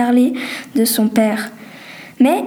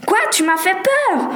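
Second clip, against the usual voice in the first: startled; 7 semitones higher than usual. Acted. Just startled by her friend.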